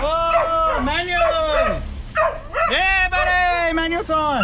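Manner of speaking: long, high-pitched celebratory cries; real elation. Real, not acted. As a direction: whoop with each word stretched high. Thrilled the car moves in manual.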